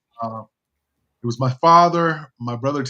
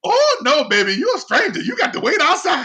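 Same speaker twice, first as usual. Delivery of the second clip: high, hammed-up refusal; mock-indignant old lady shutting out a stranger; imitating the dismissive lady; 12 semitones higher, 5 dB louder than usual. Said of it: "the lady goes".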